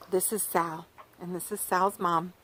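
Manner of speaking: ordinary delivery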